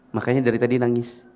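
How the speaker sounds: normal talking voice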